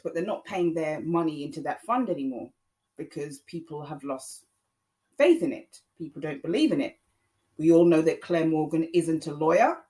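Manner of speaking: normal talking voice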